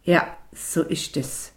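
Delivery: slow, stubborn, angry affirmation; angry defiance. A narrator's reading. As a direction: bite back defiantly. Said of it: "sagte die Mutter mit zornigem Trotz". (mit zornigem Trotz)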